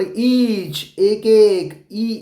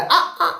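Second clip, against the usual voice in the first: higher, mock monkey "aa, aa" of pain; monkey's cry; 8 semitones higher than usual. Shown as a demonstration. Teacher acting the hurt monkey for children, higher mock squeal. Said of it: "ऐसे चिल्लाया".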